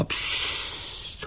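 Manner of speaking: long soft voiceless "psst"; hush. A narrator's reading. Woman hushing someone lest the neighbours hear, soft hiss. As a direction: shush them with a drawn-out hiss. Hushing during a secret encounter.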